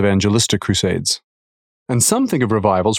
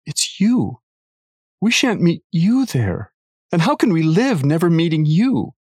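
sobbing; 7 semitones higher than usual. A narrator's reading. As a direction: cry it out as a heartbroken girl. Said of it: "sobbed Lucy".

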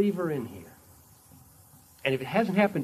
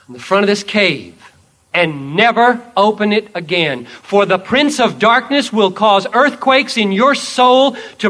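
loud, ringing declaration; triumphant declaration; 8 semitones higher, 14 dB louder than usual. Acted. Jesus declaring the dragon slain, loud and triumphant. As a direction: proclaim the victory. Preacher warns loudly.

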